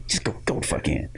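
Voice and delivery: whispered, fast urging; hushed urging. Retold or imitated. Security guy waving the boy in, whispered and fast. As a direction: hiss him through the door. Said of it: "he just looks at him like".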